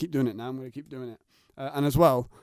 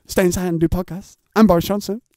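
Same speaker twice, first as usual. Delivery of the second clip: lightly voiced, louder Boris Johnson; a hint of a blustering PM; Boris Johnson impression; 5 semitones higher, 7 dB louder than usual. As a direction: podcaster jokingly imitating the Prime Minister. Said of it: "that's how he talks".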